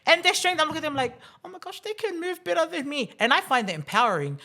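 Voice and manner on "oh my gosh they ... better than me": high, softer mock-amazed voice; retold amazement. Gym owner voicing her awe at new mums, high mock amazement. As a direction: imitate being amazed, pitched right up.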